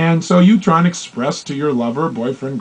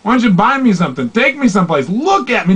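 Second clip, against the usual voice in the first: higher, dismissive talk's cheap; mock demanding lover; scoffing partner; 7 semitones higher than usual. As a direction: sneer and demand more. Acted demanding visual partner.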